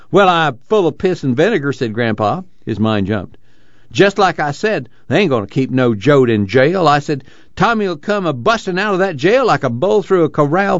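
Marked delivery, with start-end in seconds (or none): 0.1-1.7 put-on high, spirited old-man voice; high-pitched sprightly reply; feisty grandpa. A narrator's reading. Feisty grandpa answers Tom. 3.9-10.8 put-on high-pitched feisty old man; high, rambling old-timer boast; crowing grandpa. A narrator's reading. Grandpa crows about Tommy breaking out.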